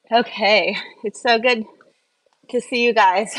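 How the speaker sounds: ordinary talk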